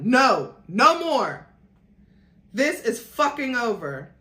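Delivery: loud, high furious shout. Acted. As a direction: yell it right in his face. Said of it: "I shouted at him".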